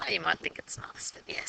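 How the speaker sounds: laughing whisper, teasing the viewer; whispered aside. Real, not acted. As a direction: drop to a playful whisper. Teasing aside about ugly gradient.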